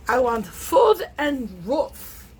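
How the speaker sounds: loud, slow mock French accent; high, emphatic French-accented imitation; imitated French accent. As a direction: demand it slowly in a put-on French accent. Imitating the French woman moving in.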